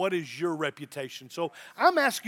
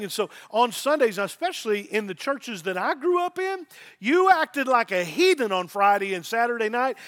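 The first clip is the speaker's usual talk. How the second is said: usual speaking voice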